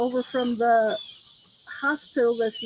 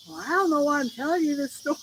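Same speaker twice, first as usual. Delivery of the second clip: higher-pitched quoted boss, a bit animated; imitating boss; 5 semitones higher, faster than usual. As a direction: lightly act out the chatty boss. Retold boss launching into a story.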